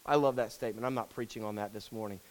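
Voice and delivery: normal talking voice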